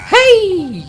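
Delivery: high, loud drawn-out holler; calling out. Acted. Game pal hailing the exposed player, high shout. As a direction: holler high to get someone's attention.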